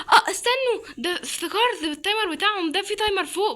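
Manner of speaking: high, loud rush of sudden remembering; high excited burst about the timer; excited realization. Real, not acted. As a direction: blurt it out as it clicks.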